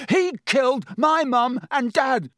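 put-on boy roars at his parents' killer; roared. A narrator's reading. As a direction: roar it with all your grief and rage. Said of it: "Harry roared".

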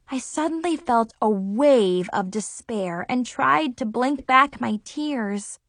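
usual speaking voice

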